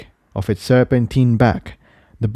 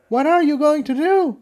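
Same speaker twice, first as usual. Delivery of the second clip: much higher voice, anxious; Tiptoe asking. Acted. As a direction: ask what the plan is. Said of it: "Tiptoe asked".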